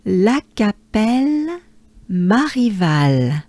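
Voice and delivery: La Capelle Marival drawn out slowly, French r; full name, slowly. Shown as a demonstration. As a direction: join the whole name slowly. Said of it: "So as a whole".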